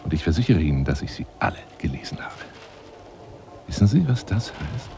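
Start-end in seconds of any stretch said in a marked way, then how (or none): 0.8-2.3 fast, much softer whisper; a quick whispered boast; confiding whisper. Acted. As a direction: rush it out in a low whisper.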